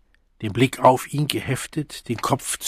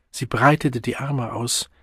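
ordinary delivery

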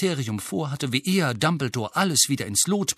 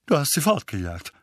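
slightly raised, assertive question; Onkel Vernon, loud; 5 semitones higher than usual. A narrator's reading. Uncle tries to regain control of talk, assertive. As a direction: assert yourself, lifting the last question. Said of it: "sagte Onkel Vernon laut".

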